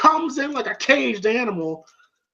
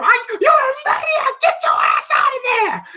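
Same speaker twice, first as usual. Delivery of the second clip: Mickey yelling. Retold or imitated. Cornerman Mickey screaming at Rocky to leave the ring. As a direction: scream at him like a frantic trainer. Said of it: "you hear Mickey going".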